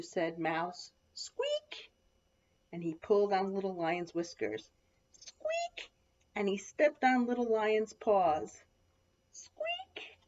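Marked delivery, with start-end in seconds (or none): 1.1-1.9 very high cub squeak; tiny squeak. A narrator's reading. Mouse pulled Little Lion's whiskers, high squeak. 5.1-5.9 very high, soft squeak; tiny squeak. A narrator's reading. Little Lion's whiskers pulled, tiny high squeak. 9.3-10.2 very high, soft squeak; tiny squeak. A narrator's reading. Little Lion's paws stepped on, tiny high squeak. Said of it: "he said again".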